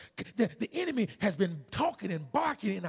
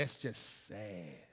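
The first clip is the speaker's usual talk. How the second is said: low, soft, drawn-out disapproval; scolding; 8 semitones lower, 8 dB quieter, much slower than usual. Retold or imitated. Preacher tells congregation to judge his silliness, slow low scolding. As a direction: imitate a slow, disappointed reproach. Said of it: "and her scolding words".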